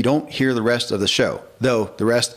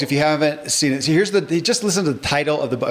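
ordinary talk

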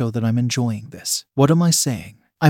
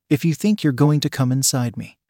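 ordinary delivery, nothing marked

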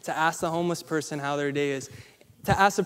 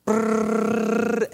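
imitated bell ring; 6 semitones higher than usual.

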